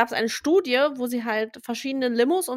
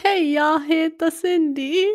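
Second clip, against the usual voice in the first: mock Swedish; 5 semitones higher, 6 dB louder, slower than usual. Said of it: "ich kann halt nix mit Schwedisch".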